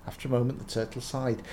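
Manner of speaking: ordinary delivery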